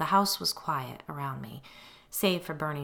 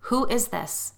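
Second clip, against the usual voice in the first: higher, louder, stern questioning; serious mom voice; 7 semitones higher, 5 dB louder than usual. A narrator's reading. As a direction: demand an answer like a stern mother. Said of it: "in my most serious mom voice".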